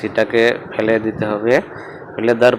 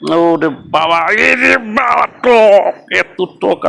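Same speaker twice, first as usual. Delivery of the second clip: high, loud sour outcry; shocked at sourness; 9 semitones higher, 3 dB louder, much slower than usual. Real, not acted. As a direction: yelp at how sour it is. Tasting very sour mango.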